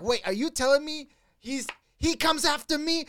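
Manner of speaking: high-pitched, outraged disbelief; losing his shit. Retold or imitated. Friend retelling Angelo's car rant, losing his shit. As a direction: act out someone losing it over a betrayal. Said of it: "He was losing his shit".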